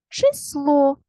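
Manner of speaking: число with a high, drawn-out final О; stress on ЛО. Shown as a demonstration. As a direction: hold the ending of число long. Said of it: "Поставте усно наголос". (число з протяжним наголосом на ЛО)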